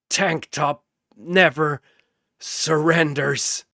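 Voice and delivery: slow defiant vow. Acted. Dying hero's defiance.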